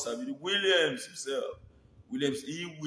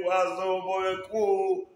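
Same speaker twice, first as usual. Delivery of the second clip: mock street greeting; 4 semitones higher, 6 dB louder, slower than usual. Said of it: "shout for Olugbo, say".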